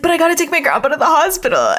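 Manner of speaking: high, fast, urgent protest; mock pleading. Yugi protesting the duel to Kaiba, mock pleading. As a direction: imitate a kid pleading to leave.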